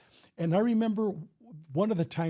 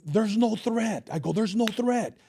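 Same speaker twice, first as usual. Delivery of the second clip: raised-pitch, insistent refusal; firm protest; 5 semitones higher than usual. Retold or imitated. Officer answers cop urging a shooting, insistent protest. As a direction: stretch it out, insisting on it.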